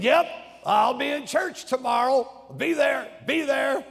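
high, loud, brushing-off shout. Retold or imitated. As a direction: yell it back like a cornered neighbor. Neighbor shouting at the door.

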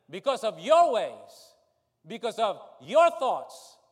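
forceful preaching. Real, not acted. Preacher's climax.

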